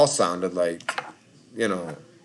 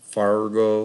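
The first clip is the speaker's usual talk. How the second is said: "Fargo" with a sounded R, light Upper Midwest accent. Shown as a demonstration. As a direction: repeat "Fargo" in a Midwestern accent, full R and round O. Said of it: "like, that accent".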